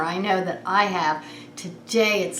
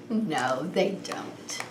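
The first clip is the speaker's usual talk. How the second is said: whisper; 6 semitones lower, 7 dB quieter than usual. Acted. Quiet answer to children.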